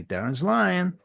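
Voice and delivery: very high, loud incredulous protest; indignant runner. Acted. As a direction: squawk in disbelief. Runner repeats to boss.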